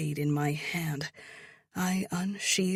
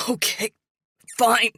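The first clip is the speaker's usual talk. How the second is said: voice breaking; 7 semitones higher, 9 dB louder than usual. A narrator's reading. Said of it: "His voice breaks".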